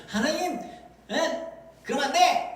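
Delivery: high-pitched telling-off; acted indignant rebuke; mock scolding. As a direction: scold as if God were at fault. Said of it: "하나님 막 혼내켜요".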